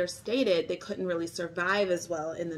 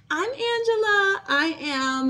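bright intro; 12 semitones higher, 6 dB louder, slower than usual. Real, not acted. High bright intro name.

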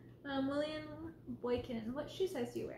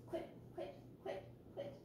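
whispered scolding; 5 semitones higher, 11 dB quieter than usual. Real, not acted. Stopping cat mid-stream.

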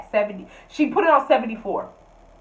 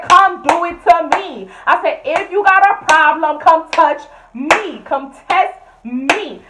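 slow, higher, louder dare; slow loud challenge; 6 semitones higher, 8 dB louder, slower than usual. Retold or imitated. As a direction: dare her slowly and loudly.

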